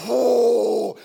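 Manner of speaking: loud blown whoosh like a gust of wind; loud mimed gust of breath. Pretend. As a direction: blow it out hard like a gale. Imitating God's blast.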